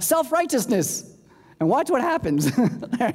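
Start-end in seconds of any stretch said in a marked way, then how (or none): 0.0-1.2 high, drawn-out call of the word; mock yell out. Preacher showing the congregation a high comeback. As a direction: sing it out high like a punchline. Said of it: "you yell out".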